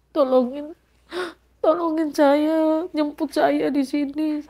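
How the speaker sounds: slow, pleading, on the edge of crying; retold weepy call for help; tearful plea. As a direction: beg a friend for rescue, drawn out. Retold tearful phone plea to friend.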